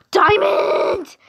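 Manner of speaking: high, loud gasping question; excited gasp. Real, not acted. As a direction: gasp with excitement mid-line. Gasp at friend's diamond trick.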